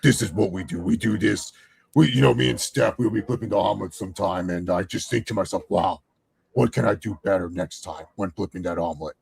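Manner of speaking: low-voiced wrestler impression; Triple H. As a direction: do a low, self-reflective celebrity confession. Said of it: "That's what Triple H would say about it".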